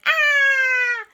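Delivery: very high, loud, long shriek; shrieking ride scream. Acted. Boy screams on the roller coaster, high shriek. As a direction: scream as on a big drop.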